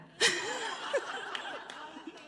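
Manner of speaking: high laughter. Real, not acted. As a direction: let out a laugh.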